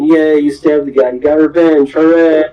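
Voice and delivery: hammed-up crowd cheer building up; mock cheering. Pirates cheering Nami after the stabbing, mock cheer. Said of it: "Everybody's cheering".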